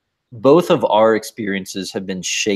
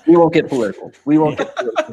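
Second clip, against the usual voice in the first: teasing; 8 semitones higher, 4 dB louder, much faster than usual. Pretend. Teasing deflection, laughing.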